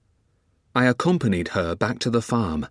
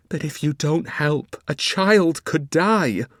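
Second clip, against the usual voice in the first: urgent exclamation; 6 semitones higher, slower than usual. Acted. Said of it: "I exclaimed".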